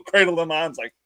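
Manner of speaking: usual speaking voice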